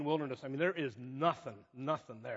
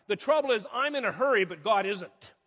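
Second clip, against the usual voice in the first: agitated hurry; 4 semitones higher, 6 dB louder than usual. Retold or imitated. Agitated preacher answering friend.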